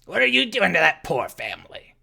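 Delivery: furious neighbor yelling; angry shout. Acted. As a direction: shout across at the intruders. Half-orc shouts accusation.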